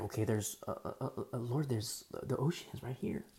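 soft, stammering, faltering objection; hesitant protest. Acted. Moses points out the ocean to the Lord, hesitant stammer. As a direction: fumble for words in a small voice.

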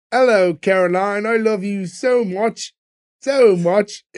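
slow, mock drunk British accent; hammy drunk Brit on the phone, slowly; bad British accent. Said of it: "a very bad British accent".

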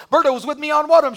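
usual speaking voice

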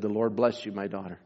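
low, hushed blessing; softly bestow a blessing, voice dropping away; tender blessing. Retold or imitated. Beggar woman blesses the giver, low and tender.

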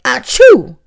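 loud sneezy achoo cry; book's yelp turning into a sneeze; sneeze-like ouch. A narrator's reading. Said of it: "which sounded more like".

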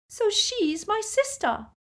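very high, slow, tearful protest; upset retort. A narrator's reading. Lily tearful, rebukes Snape.

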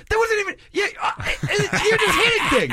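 laughing shout. Real, not acted.

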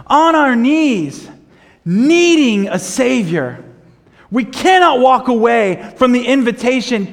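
loud, high, slow preaching with pounding stress; preaching at full force. Acted. Pastor urges congregation to surrender, full-force preaching. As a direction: preach it out, every phrase hammered home.

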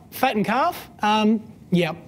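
ordinary talk

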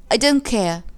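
loud, defiant retort; stubborn, forceful dismissal; defiant child. A narrator's reading. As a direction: push back like a sulky child. Child defies mother in quarrel.

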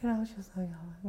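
very soft, barely audible mumble; hushed, indistinct whisper-talk; whispering daughter. Retold or imitated. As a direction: imitate a daughter who whispers when she talks. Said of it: "My older one whispers".

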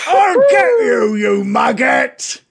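very high, loud enraged orc shout; screamed threat. Acted. Wounded orc threatens his attacker, screaming furiously. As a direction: scream it at full force, dragging out the insult. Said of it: "And he screams out".

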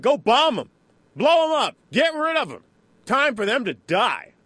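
loud, high-pitched tabloid rallying cry; hammed-up call to attack; mock war cry. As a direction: punch out each order high and loud, mimicking hawkish headlines. Acting out tabloids urging bombing.

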